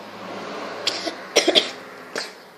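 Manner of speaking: hard, rasping coughing fit. Real, not acted. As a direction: cough hard, nearly choking. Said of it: "Je suis en train de me tuer". (grosse quinte de toux)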